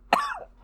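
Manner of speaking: sharp painful cough; harsh cough. Real, not acted. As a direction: cough it out hard. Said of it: "I'm dying".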